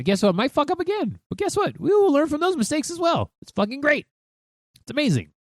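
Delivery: giddy pep talk; excited. Real, not acted. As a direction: cheer it on, voice jumping way up. Excited encouragement.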